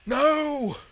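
high, very loud panicked yell; wild yell. A narrator's reading. Villain reels under the sorceress's attack, wild yell. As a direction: yell it out wildly at full voice. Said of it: "he yelled, wild-eyed".

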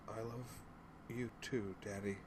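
put-on very soft, high, halting girl; weak, effortful reply. A narrator's reading. Daughter fading into sleep answers her father, weak and effortful. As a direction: barely get the words out, pausing midway. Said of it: "marshaled all her strength to reply".

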